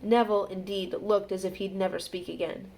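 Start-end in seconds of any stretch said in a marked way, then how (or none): none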